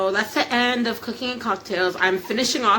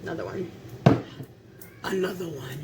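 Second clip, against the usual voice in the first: low, quiet hype-man catchphrase; 5 semitones lower, 5 dB quieter than usual. Retold or imitated. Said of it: "In my [name] voice".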